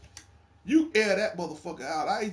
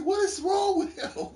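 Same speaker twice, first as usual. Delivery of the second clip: high and slowed, baffled; mock baffled whine; high disbelief; 12 semitones higher than usual. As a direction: stretch it out in high-pitched disbelief. Quoting own disbelief.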